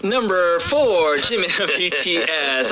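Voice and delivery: slow drawn-out announcement; dramatic reveal. Shown as a demonstration. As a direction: stretch it out before the big answer. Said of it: "I'm just expecting a drum roll".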